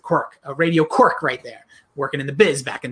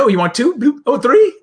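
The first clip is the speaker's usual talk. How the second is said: higher, louder British nanny imitation; attempted British accent; 7 semitones higher, 6 dB louder than usual. Host acting out the nanny serving tea, British accent. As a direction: lift it into a put-on British accent. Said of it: "British accent right now".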